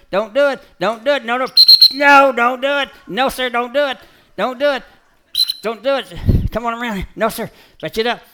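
high, loud commands to the dog; calling to the dog. Real, not acted. Trainer warning his dog off the bait, loud and high.

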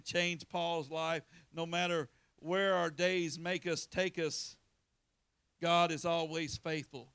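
fervent sermon build; preaching. Acted. Preaching builds from line 121.

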